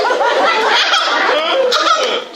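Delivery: high, bright burst of real laughter. Real, not acted. Telling audience about the 911 call, real laugh.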